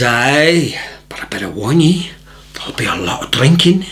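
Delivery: put-on deadpan Scottish accent, half-whispered; Scottish warning. Scotsman warns the newcomer about drinking, hushed Scottish accent. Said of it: "beautiful Scottish accent".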